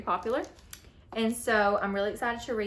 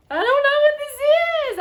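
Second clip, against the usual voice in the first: excited puzzlement; 12 dB louder, faster than usual. Real, not acted. Struggling to open mystery item.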